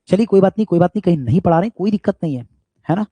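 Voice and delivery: loud, high-pitched dismissal; defiant brush-off. Retold or imitated. Acts out brushing off the school. (ऊँची आवाज़ में बेपरवाही से)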